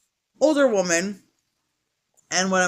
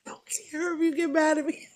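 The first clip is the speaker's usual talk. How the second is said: laughing whisper; 8 semitones higher, 6 dB quieter, faster than usual. Real, not acted. Laughing defiance.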